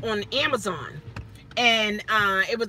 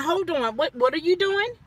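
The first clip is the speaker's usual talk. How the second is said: normal talking voice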